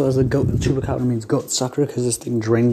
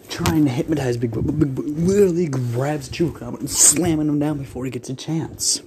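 normal talking voice

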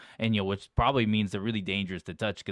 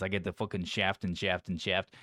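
ordinary talk

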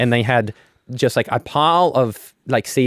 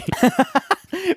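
high laugh. Real, not acted.

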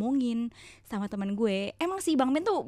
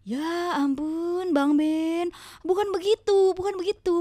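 exasperated; 6 semitones higher, 5 dB louder than usual. Retold or imitated. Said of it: "correcting husband, exasperated".

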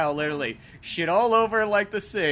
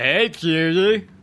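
put-on slow, louder sleazy drunk voice; 3 semitones higher, 5 dB louder, much slower than usual. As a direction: slur it like a drunk pickup line.

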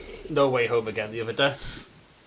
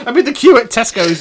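ordinary talk

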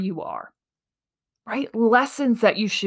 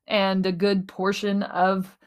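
ordinary delivery

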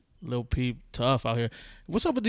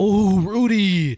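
slow, breathy swoon, much higher; 6 semitones higher, 8 dB louder, much slower than usual. Retold or imitated. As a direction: melt over the name. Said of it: "And I'm like".

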